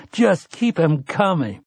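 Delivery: high, slow, tears in the throat; tearful plea. Acted. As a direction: beg through held-back tears. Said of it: "speaking through a throat full of tears".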